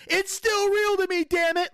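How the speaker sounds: high, strained mock protest; hammed-up emotional outcry; overwrought catchphrase. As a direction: imitate an impassioned fan pleading his case. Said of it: "becoming the modern day version of".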